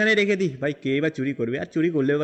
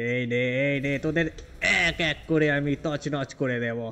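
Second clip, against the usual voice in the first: villain threat; 4 semitones lower, 4 dB quieter than usual. Acted. Villain threatens to wreck them.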